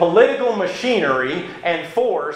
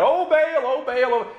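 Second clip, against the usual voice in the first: crying out; 8 semitones higher than usual. Retold or imitated. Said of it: "just crying out".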